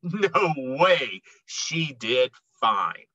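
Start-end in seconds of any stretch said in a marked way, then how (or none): none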